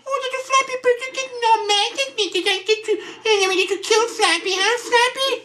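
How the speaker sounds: very high, squeaky taunting voice; mock-cutesy falsetto teasing; mocking baby talk. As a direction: taunt the bird in a silly high-pitched voice.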